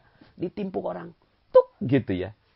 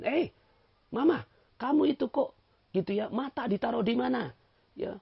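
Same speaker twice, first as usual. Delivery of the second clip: higher, exasperated mock scolding; teasing his wife for putting salt in the coffee; mock scolding husband; 10 semitones higher than usual. Husband mocking his wife's coffee mistake, higher and exasperated.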